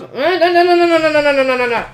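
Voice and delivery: high, loud rapid string of no's; panicked refusal. Acted. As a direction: blurt no over and over to drown him out.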